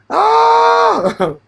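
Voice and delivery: high delighted cry. Real, not acted. Loud amused exclamation.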